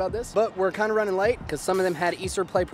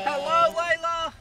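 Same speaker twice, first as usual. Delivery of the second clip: put-on slow, high sing-song greeting to a baby; baby talk; 10 semitones higher, slower than usual. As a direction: coo it to your baby girl. Father greets his baby daughter.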